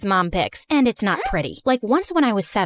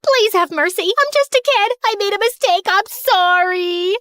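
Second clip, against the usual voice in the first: pleading in fear; 8 semitones higher, 4 dB louder than usual. Acted. Begging when caught hiding.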